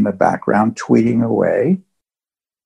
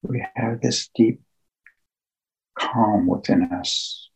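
slow, hushed meditation guide with long pauses; guided meditation; 7 dB quieter than usual. Acted. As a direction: ease listeners into calm, phrase by phrase, leaving long silences.

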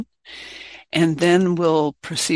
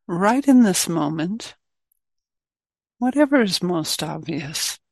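guided meditation. Real, not acted. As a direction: guide the group gently inward, leaving space between phrases.

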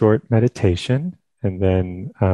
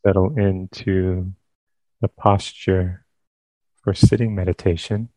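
calm, unhurried phrases with long pauses; guided meditation. Real, not acted. Teacher leading listeners into sitting meditation, calm guided meditation. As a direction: guide them gently into stillness, leaving space between phrases.

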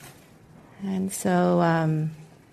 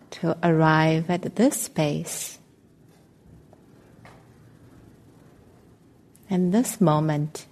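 calm, measured meditation voice with long pauses; guided meditation. Acted. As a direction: guide listeners gently into stillness, leaving space between phrases.

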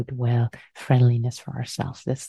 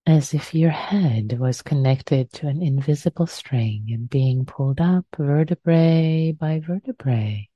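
guided meditation. Acted. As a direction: ease them gently into stillness.

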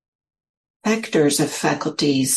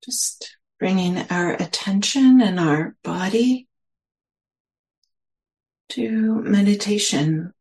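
unhurried meditation guidance with long pauses; guided meditation; 2 semitones higher than usual. Real, not acted. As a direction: gently guide listeners inward, leaving space between phrases.

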